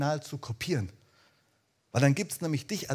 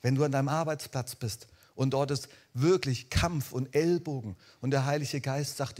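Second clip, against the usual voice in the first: usual speaking voice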